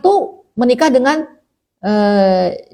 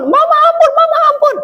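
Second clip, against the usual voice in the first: put-on very high, wailing child voice; shrill mock pleading; child begging for mercy. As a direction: imitate a pinched child pleading with mother. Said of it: "Anaknya minta ampun".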